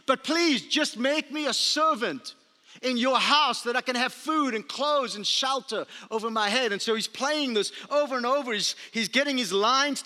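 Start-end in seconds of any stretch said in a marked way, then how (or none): 0.0-6.7 a hint of a son practicing his apology; rehearsed plea. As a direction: son rehearsing his speech to his dad, pleading. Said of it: "he memorizes this line".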